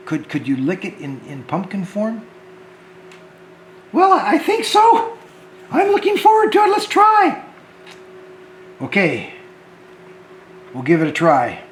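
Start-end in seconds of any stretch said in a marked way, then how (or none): 3.9-7.4 high puppet voice, rising to a cheer; puppet George. Acted. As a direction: answer high and eager as the puppet, then call out. Puppet George answering the host.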